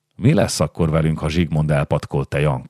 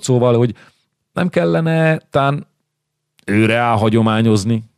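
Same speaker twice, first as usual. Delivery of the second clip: higher, wheedling nobleman coaxing Jankó; sly insinuating noble; 5 semitones higher than usual. Acted. Újlaki coaxes Jankó toward treason, sly and higher.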